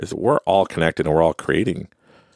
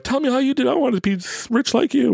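much higher whiny mock asker; mocking eager fans. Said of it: "people were like".

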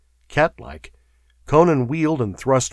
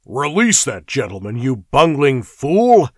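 angry; 4 semitones higher, 6 dB louder than usual. Acted. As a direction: swear at the fool. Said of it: "swore the prefect".

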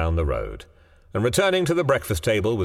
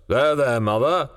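hasty; 6 semitones higher, 4 dB louder than usual. A narrator's reading. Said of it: "he said hastily".

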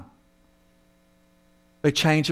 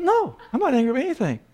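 wife's quick denial; 7 semitones higher, faster than usual. Retold or imitated. Acted wife quickly denying anger.

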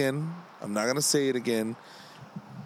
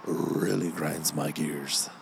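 much lower, slow, drawn-out catchphrase voice; deep mock announcer; 8 semitones lower, 3 dB quieter, slower than usual. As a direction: lower your voice and stretch every really like a cartoon dad.

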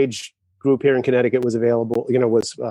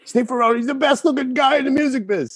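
quoting a friend; 12 semitones higher than usual. Retold or imitated. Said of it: "T-Bone always used to say that".

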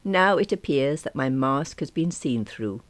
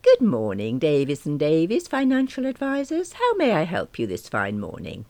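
posh, polished receptionist voice; best telephone manner; 5 semitones higher, 4 dB louder than usual. Acted. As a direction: answer like a very proper receptionist. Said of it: "in my absolute best telephone manner".

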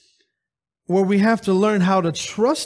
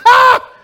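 loud high mocking "Ha!"; scornful laugh; 10 dB louder than usual. Preacher scoffing at a smug churchgoer, loud laugh. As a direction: scoff loudly at them.

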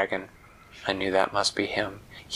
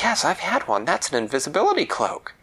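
put-on eager child voice; 7 semitones higher than usual. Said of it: "Winella said".

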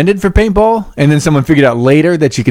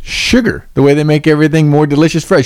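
ordinary delivery

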